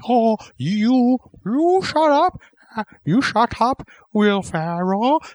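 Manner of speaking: squeaky taunt, shut up; high mocking voice. Voicing Sandler snapping at Ferrell.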